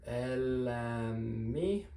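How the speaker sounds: "elämi" stretched very slowly, each syllable held; drawn-out Finnish word. Shown as a demonstration. Teacher modelling the new stem slowly for students. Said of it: "So we're going to go".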